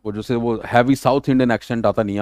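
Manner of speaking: normal talking voice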